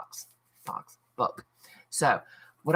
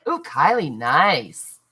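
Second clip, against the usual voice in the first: delighted ooh; pleased, drawn out; impressed; 7 dB louder, slower than usual. Real, not acted. Impressed reaction to viewer.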